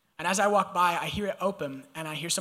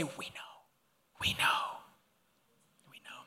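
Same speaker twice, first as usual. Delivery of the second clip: group whisper of we know; hushed whisper; 8 dB quieter than usual. Real, not acted. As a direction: breathe it softly to your neighbor. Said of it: "You actually whispered it".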